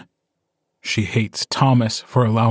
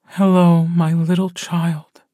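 put-on higher, slow, tender hello to a baby; tender mother; 6 semitones higher than usual. A narrator's reading. A mother greeting her newborn son in her arms, tender and higher.

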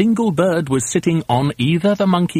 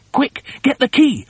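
high-pitched calling out; urgent call; 12 semitones higher, 3 dB louder than usual. Acted. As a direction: call urgently to a friend up on the roof. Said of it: "Mugglewump called out".

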